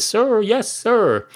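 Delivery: high-pitched mock recruit answering an order; military response. Barman answers the cat's order, high military response. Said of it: "I'm from a military background".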